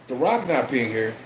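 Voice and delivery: normal talking voice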